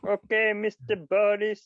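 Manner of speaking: put-on high, slow comic Indian accent; Rishi. Rishi answering Boris, put-on Indian accent. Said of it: "he's got one voice".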